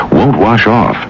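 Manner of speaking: usual speaking voice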